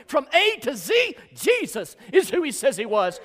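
building from strong to a loud, high preaching cry; full-force Southern preacher crescendo; rising preaching. Acted. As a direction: climb each line until you are shouting the gospel. Alphabet litany builds to climax.

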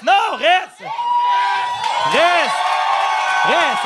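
loud insistent shout to stay. Real, not acted. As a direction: yell at him to stay put. Begging a guest to stay.

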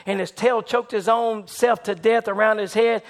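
ordinary delivery

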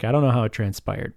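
normal talking voice

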